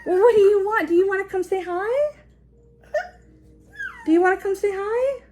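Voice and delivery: lilting invite to say hi. Real, not acted. Mom asks her toddler to come say hi, high singsong.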